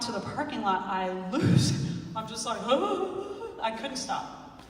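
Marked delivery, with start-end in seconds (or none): 2.6-3.5 high mock wail; drawn-out pretend bawl; imitated hysterical crying. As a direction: imitate breaking into helpless sobs. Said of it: "I'm hysterical".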